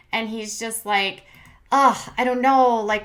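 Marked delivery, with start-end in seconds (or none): 1.7-2.8 loud, higher groaning "ugh"; hammed-up weary groan; mock groan. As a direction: groan like he's fed up. Voicing David's groan.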